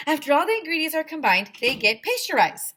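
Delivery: high, loud and fast, rattling off facts; fast lecturing vendor. Acted. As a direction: gabble the lesson like an eager know-it-all.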